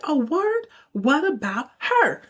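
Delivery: high, slow mock sass; sassy comeback. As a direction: throw it back at him with attitude.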